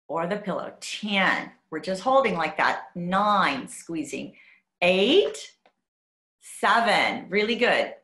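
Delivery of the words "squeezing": ordinary talk, nothing marked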